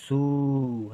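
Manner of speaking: सू with a long held oo; drawn-out vowel. Shown as a demonstration. Teacher explaining the big matra, drawn-out vowel. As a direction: hold the vowel in सू. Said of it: "लेकिन देर तक".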